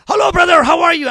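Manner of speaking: high, loud shouted greeting; excited greeting. Retold or imitated. Newly arrived Nuhum greeting a stranger, excited shout. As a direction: burst out with eager joy. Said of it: "He was excited".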